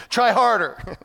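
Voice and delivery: loud, high jeer from the sidelines; hammed-up coaching shout; mock heckling. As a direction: yell it like a useless bystander. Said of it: "throwing rocks at them and saying".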